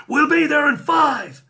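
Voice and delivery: high, loud mock shout; imagined police officer. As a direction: call it out like an over-keen cop. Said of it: "I wonder what the Manchester Met would say".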